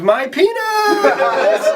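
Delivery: high, long-drawn comic yell; hammed-up high holler; mock scream. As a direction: scream it out triumphantly, stretching the words. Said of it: "as Sam screamed".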